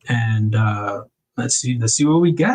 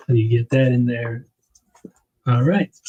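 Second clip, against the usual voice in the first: normal talking voice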